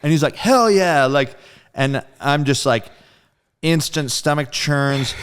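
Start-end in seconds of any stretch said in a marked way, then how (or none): 0.4-1.0 high, loud "hell yeah"; higher and louder joyful cheer; excited cheer. Retold or imitated. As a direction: whoop it like a thrilled bandmate. Bandmate's excited answer.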